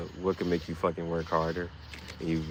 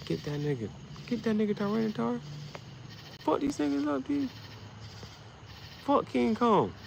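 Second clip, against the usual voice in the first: much higher, egging the monster on; high-pitched fan cheering at the TV; excited cheering; 13 semitones higher, 4 dB quieter than usual. Real, not acted. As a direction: hype up your fighter on screen. Cheering Tyranitar on.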